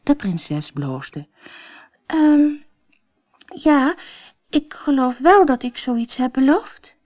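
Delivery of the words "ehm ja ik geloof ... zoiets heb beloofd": a high, hesitant admission; sheepish princess. Acted. Princess admits her promise to the king, high and hesitant. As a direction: own up shyly.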